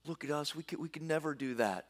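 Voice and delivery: soft, defeated mock whimper; whining despair. The fearful spies tell the people, hushed mock despair. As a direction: voice the spies giving up. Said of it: "they weep and wail".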